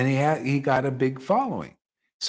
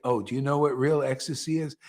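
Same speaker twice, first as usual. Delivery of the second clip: ordinary talk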